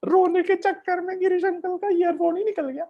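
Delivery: high, fast whiny crybaby wail; mock crying child. Teacher to kids, hammed-up child's crying wail. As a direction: wail like a child who lost something.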